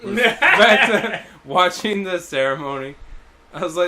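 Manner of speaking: usual speaking voice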